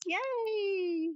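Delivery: high, loud happy cheer. Acted. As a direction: let it burst out with joy. Cheering at the cats.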